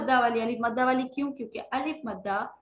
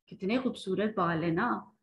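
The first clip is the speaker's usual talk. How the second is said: usual speaking voice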